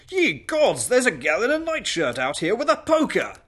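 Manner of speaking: high, loud, hammed-up exclamation; startled dinner guest. Guest spots girl with a poker, startled loud cry. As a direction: cry out as an astonished party guest.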